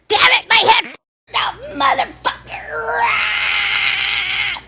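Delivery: high, loud cursing outburst; exasperated high shout; real frustrated yell. Real, not acted. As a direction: yell at the broken headset, ending in a long scream. Cursing at failing headset.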